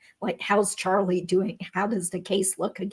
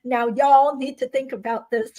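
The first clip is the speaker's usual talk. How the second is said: put-on New Yorker faking a Southern accent; mock y'all from a city lawyer; phony Southern drawl; 3 semitones higher than usual. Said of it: "Said with a New York accent".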